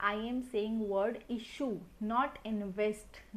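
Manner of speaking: very slow, each word spaced, weight on issue and invest; teacher slows right down to contrast issue and invest; stress on issue. Shown as a demonstration. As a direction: lay out issue against invest, slowly and clearly. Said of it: "यहाँ पे ध्यान रखना".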